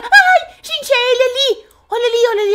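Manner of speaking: sudden frightened high cry; startled at seeing him; alarmed. Real, not acted. Spots the monster, alarmed.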